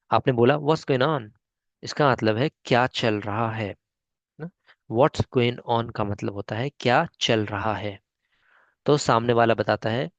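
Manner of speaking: ordinary delivery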